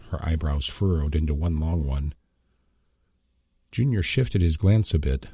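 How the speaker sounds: ordinary delivery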